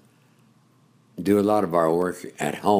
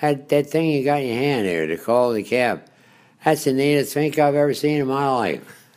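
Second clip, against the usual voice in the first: imitated Baltimore cabbie, higher and amazed; light retold Baltimore accent; 7 semitones higher, 4 dB louder than usual. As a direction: gush like a wowed Baltimore cab driver. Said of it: "I loved the Baltimore accent".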